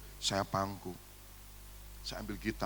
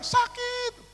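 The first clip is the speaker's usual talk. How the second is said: put-on child's cry of pain. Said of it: "dia nggak lagi teriak".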